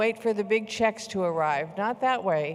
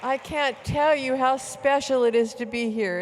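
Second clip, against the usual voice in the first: normal talking voice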